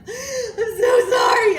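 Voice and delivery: slow, high and loud, half sobbing; retold sobbing apology. Caller sobbing an apology to the dispatcher, imitated crying. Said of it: "she's crying hysterically".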